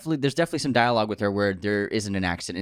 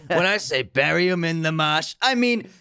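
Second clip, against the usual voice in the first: higher mock Boston accent, slowing to a long drawl; Jack Nicholson's Boston accent; 6 semitones higher, slower than usual. Gangster boss giving burial orders, hammed-up Boston accent. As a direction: build to a hugely stretched, accented last phrase. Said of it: "Jack sounds great".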